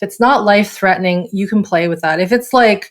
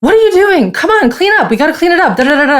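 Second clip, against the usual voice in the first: much higher, louder barking scolding; angry dad; 10 semitones higher, 5 dB louder, much faster than usual. Retold or imitated. Marine dad scolding son over spilled milk, loud angry high bark. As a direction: snap at the kid like a furious father. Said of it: "his dad was like".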